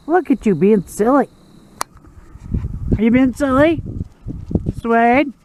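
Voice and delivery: high, teasing question to the dog; dog-talk voice. Real, not acted. Owner teasing his dog, high and cooing.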